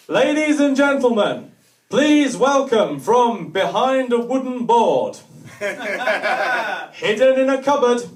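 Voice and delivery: loud, slow, booming mock announcer. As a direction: bellow it like a hammy compere introducing yourself. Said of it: "the least showbiz thing you'll ever see".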